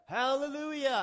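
loud, high hallelujah; rider's high, jubilant command; called-out command. Acted. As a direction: call it out to a galloping horse. Calling a command to his horse.

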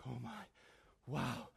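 low, hushed amazement; acted soft daze after a scare; dazed. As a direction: murmur it in stunned relief. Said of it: "He's dazed, he's huffing".

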